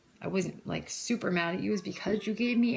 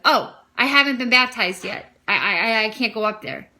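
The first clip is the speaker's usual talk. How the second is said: retold alarm; 3 semitones higher, 10 dB louder than usual. Startled realization, retold.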